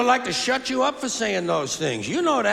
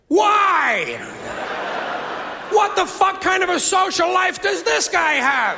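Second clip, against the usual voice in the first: incredulous shout; 9 semitones higher, slower than usual. Retold or imitated. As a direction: yell it in disbelief. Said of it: "And I'm thinking to myself".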